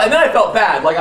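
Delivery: ordinary talk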